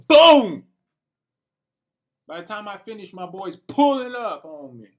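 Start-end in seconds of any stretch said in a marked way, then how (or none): 0.1-0.6 much louder and higher, a burst; sudden loud boom. Real, not acted. Telling viewers his friend suddenly arrived, loud exclaim. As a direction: blurt the boom out hard.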